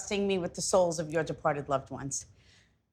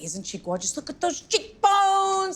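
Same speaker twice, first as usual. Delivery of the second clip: cousin's gushing voice; 10 semitones higher than usual. Retold or imitated. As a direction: gush over the cheekbones like the late cousin. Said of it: "That's how she talks".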